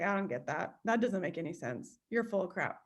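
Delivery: low, quiet muttered confusion; confused grumble. Retold or imitated. Student stuck on the lesson, quietly grumbling.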